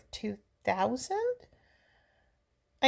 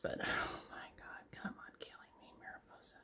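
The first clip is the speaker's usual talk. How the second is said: exasperated whisper; 15 dB quieter than usual. Real, not acted. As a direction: whisper it in despair. Exasperated at the movie.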